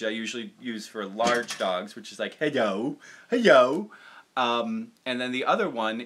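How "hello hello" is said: Baxter voice. Shown as a demonstration. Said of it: "the Baxter voice".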